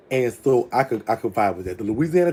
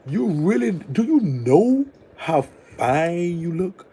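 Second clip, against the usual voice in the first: higher, laughing, drawn-out swoon; swooning plea; 6 semitones higher than usual. Retold or imitated. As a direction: beg him like you're melting. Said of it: "I'm thinking to myself".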